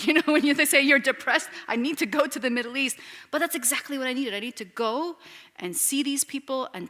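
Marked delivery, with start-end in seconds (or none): 0.0-1.5 laughing. Retold or imitated. Husband's teasing reply, laughing. 1.7-3.0 louder, faster, amused; teasing mock quote. Husband teasing his wife about her odd request, amused and louder. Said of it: "He said".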